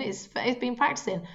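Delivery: ordinary delivery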